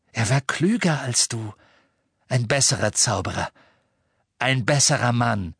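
usual speaking voice

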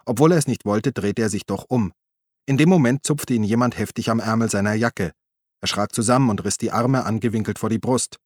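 plain reading voice

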